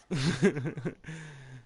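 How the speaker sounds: relieved laugh after a fright; nervous startled laugh. Real, not acted. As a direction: laugh off the scare. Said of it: "You scared the shit out of me".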